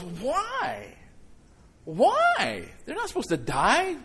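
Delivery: a mild grieving cry over a lost dog; anguished why. Retold or imitated. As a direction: pastor reliving his dog's death, pained outcry. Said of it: "my first thought was".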